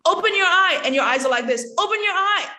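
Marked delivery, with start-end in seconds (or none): 0.1-0.8 high, loud mock order; emphatic command. Shown as a demonstration. Coach demonstrating a needless order to an actor, emphatic command. Demo of absurd scripted command. 1.8-2.5 emphatic command. Shown as a demonstration. Repeats absurd command demo.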